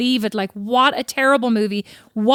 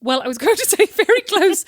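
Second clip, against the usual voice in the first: high, giggling through the words; laughing; 9 semitones higher than usual. Real, not acted. Laughing to her sister about the office cry. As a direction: laugh it out, pitch up. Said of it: "laughing while answering".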